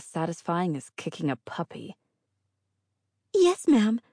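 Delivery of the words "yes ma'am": high timid yes ma'am; meek and eager; timid girl voice. A narrator's reading. Inga replies timidly.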